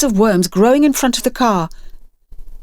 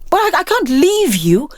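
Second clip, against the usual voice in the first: anxious protest; 10 semitones higher than usual. A narrator's reading. Sarah Jane protests leaving the boys.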